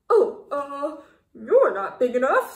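loud, flustered brush-off; blurted loud excuse. A narrator's reading. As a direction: bluster out of the fight. Ladybug backs down loudly.

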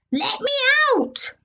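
high panicked plea; high trapped cry. Acted. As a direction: cry out from inside the piano. Child trapped in piano.

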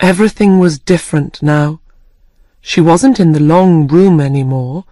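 plain reading voice

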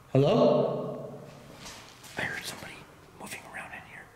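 hushed, nervous whisper; nervous night vlog whisper. Real, not acted. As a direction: whisper so nobody hears. Exploring dark prison, hearing noises.

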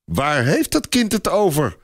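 high, bewildered question; astonished woman's high-pitched query; flabbergasted. A narrator's reading. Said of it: "stamelt vrouw Béchet verbluft".

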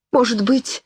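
plain reading voice

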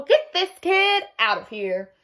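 high, slow, put-on voice; exasperated principal. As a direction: drag out the exasperation. Fed-up principal.

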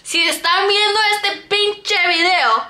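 Spanish spoken awkwardly by an English speaker; no sabo Spanish. Real, not acted. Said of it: "I'm about to speak in Spanish".

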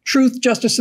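ordinary delivery

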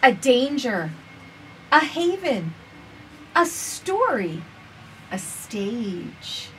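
gentle, measured storybook reading; reading to children. Acted. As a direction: read it slowly to little listeners. Reads picture book aloud.

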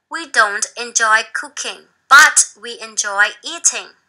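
slow, clear reading for learners; slow read-aloud. Shown as a demonstration. Teacher reads the passage slowly for students to follow. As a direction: read it line by line for the class.

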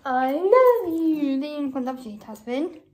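doting singsong to a little one. Real, not acted. Playing with her young daughter.

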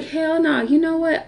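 high-pitched brush-off; indignant refusal. Retold or imitated. Indignant refusal as she leaves.

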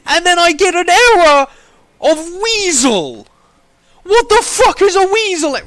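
high, loud furious yelling; outraged rant. Real, not acted. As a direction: rant at the error in real outrage. Rages at error after queueing.

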